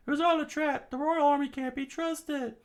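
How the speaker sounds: very high, fairly loud falsetto; betrayed rebel's voice. Acted. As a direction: accuse them in high falsetto.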